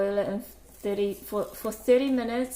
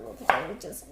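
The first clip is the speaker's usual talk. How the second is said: ordinary delivery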